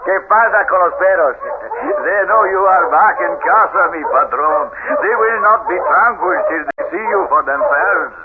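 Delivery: put-on high, loud Spanish-accented servant. Servant Juan welcoming his master home, in a Spanish accent.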